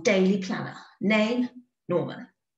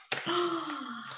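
gasp; 8 semitones higher, 7 dB quieter than usual. A narrator's reading. Storyteller relief moment.

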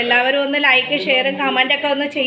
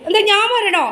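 much higher, giggly teasing question; laughing pet talk; 10 semitones higher, 4 dB louder than usual. Real, not acted. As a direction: tease the dog while laughing.

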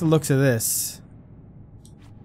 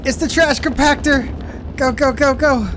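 excited shouting; 6 semitones higher than usual. Real, not acted. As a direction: shout it in a rush of panic. Chase scene in horror game.

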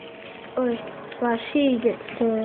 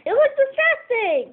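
much higher, mocking tone; mocking squeaky voice; 12 semitones higher, 4 dB louder than usual. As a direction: mimic them in a squeaky taunt. Mocking sibling's argument.

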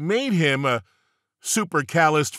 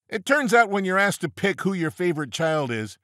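usual speaking voice

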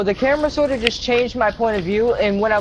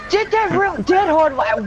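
high, slow, exasperated yell; frustrated shout; 9 semitones higher than usual. Real, not acted. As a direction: shout it out in disbelief. Frustrated at lag in game.

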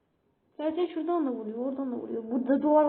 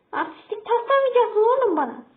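higher, louder telling-off; scolding shout; 6 semitones higher, 5 dB louder than usual. Real, not acted. Streamer scolding a show-off opponent, loud and indignant.

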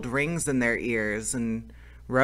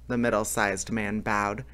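ordinary delivery

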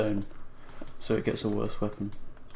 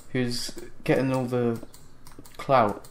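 ordinary delivery

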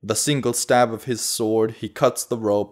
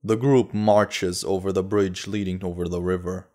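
plain reading voice

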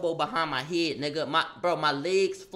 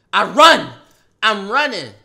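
excited yell; 2 semitones higher, 14 dB louder than usual. Retold or imitated. Sprinting at the victim.

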